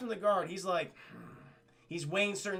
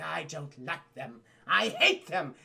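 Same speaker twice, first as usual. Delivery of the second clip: slow put-on royal distaste, hate punched louder; sulky princess; 3 semitones lower, 3 dB louder, slower than usual. Princess once the gargoyles have gone, petulant. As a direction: sulk slowly, then spit out the hatred. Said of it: "the princess, she's all".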